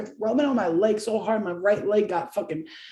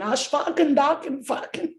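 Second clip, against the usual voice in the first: mock German gibberish; 7 semitones higher than usual. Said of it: "going in".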